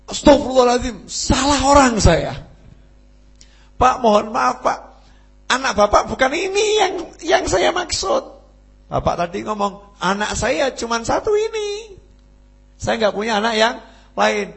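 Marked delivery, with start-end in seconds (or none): 0.0-2.4 high, loud cry of dismay; acted outcry at a terrible mistake; shocked shout. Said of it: "Langsung teriak". 3.8-8.2 high, urgent apology; acted alarm, pitched high; panicked protest. Horrified groom protests to father-in-law. 10.0-14.5 high-pitched insistent protest; acted indignation, voice raised in pitch; indignant retort. Father-in-law retorts to the groom.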